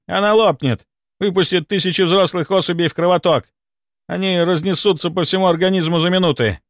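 high-pitched urgent explanation; alarmed warning. A narrator's reading. Seer predicting parasites flooding the bloodstream, alarmed. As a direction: warn of imminent catastrophe. Warning that the queen will burst.

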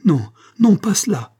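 high, loud anguished no; terrified cry. A narrator's reading. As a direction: scream it in horror. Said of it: "cria-t-elle". (cri d'effroi aigu)

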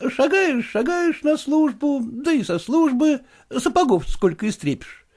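высокий голос жалобного молодого просителя; жалуется генералу на тяжёлую жизнь; голос Куцина. Acted. Kutsin voiced by the reader. (молодой проситель Куцин жалуется генералу, голос выше)

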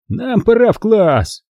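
slow, high, cautious interruption; uneasy Chad. A narrator's reading. Chad dragging Rob off to class, slow and uneasy. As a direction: pull your friend away.

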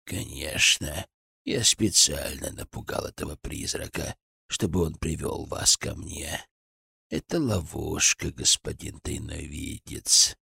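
put-on low, slow, hollow old man's voice; hollow cracked voice. A narrator's reading. Old villain Gradov speaks. (глухо и надтреснуто, низко)

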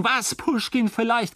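high, incredulous sneer; mocking echo. A narrator's reading. Husband mockingly repeats the wife's taunt, pitched high. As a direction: throw her words back, sneering.

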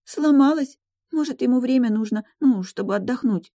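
put-on high-pitched plaintive girl; whiny, tearful. A narrator's reading. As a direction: whimper it hopefully. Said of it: "плаксиво констатировала девушка".

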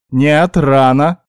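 loud, drawn-out halt; urgent objection. A narrator's reading. Elf stopping guinea pig from biting, loud drawn-out protest. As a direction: stretch each word slowly and firmly. He stops the bite just in time.

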